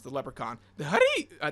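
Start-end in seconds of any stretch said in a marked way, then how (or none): 0.9-1.3 Northern Irish lilt. Shown as a demonstration. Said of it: "in Northern Irish, think of the guy from Lucky Charms".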